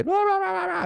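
high, squeaky mock kid chatter; hyper kid noise. Dad imitating sugar-high kids, high squeaky chatter. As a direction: mimic a kid bouncing off the walls on sugar. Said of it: "I've seen kids where they".

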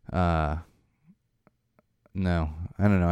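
ordinary talk, nothing marked

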